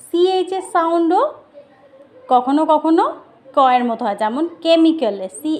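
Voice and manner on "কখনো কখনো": normal talking voice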